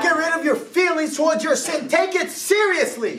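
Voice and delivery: fired-up preacher shouting the call; preaching at full force. Acted. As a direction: drive the call to repent home. Preaching climax.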